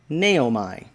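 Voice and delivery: Naomi as nay-OH-my, stress on the first syllable; self-pronouncing Naomi. Shown as a demonstration. Teacher reading the chart's version of Naomi aloud, nay-oh-my. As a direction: read Naomi off the chart as nay-oh-my, ending in a long I. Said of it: "the way that you say this name is".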